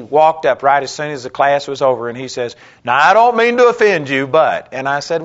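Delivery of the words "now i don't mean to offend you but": higher, louder stiff polite opener; mock the critic's prim lead-in before a rebuke; imitating the critic. Critic stops the preacher after class, a higher, louder mock-polite tone.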